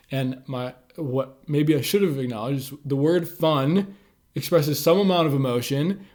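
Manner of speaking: normal talking voice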